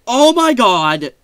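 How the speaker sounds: loud, high, drawn-out burst of awe; excited exclamation. Real, not acted. Vlogger thrilled that the mod exists, loud excited outburst. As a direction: gush it out, overjoyed. Raving about a mod he found.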